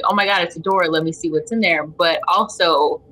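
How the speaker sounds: ordinary delivery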